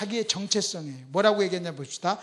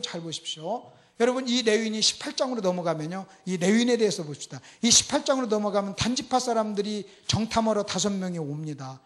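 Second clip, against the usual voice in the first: ordinary talk, nothing marked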